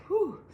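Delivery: a high breathy whew of relief; mock sigh of relief; relieved whew. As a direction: let the fear out in one breath. Relief after fright.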